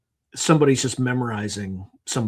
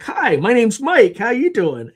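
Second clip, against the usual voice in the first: high, slow, louder mock-friendly hello; cheery greeting; 9 semitones higher, 7 dB louder, slower than usual. Friend Mike cheerfully introducing himself to the woman. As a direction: ham up an overly friendly introduction.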